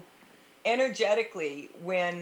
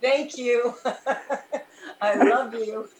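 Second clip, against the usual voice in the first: giggling through the words, higher and louder; can't hold back the laughter while saying it; laughing; 5 semitones higher, 5 dB louder than usual. Real, not acted. Giggling through the mantra on air.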